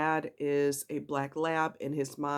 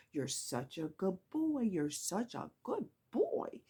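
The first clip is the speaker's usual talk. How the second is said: soft, coaxing praise for a good dog; praising voice; 3 semitones higher, 6 dB quieter than usual. Shown as a demonstration. Praising the dog after each paw, soft coo. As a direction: coo it gently, like rewarding a pet. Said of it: "make a big deal about it".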